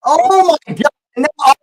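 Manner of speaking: high, loud cry of disbelief; shocked cry. Real, not acted. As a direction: gasp it out in shock. Disbelief at the foul.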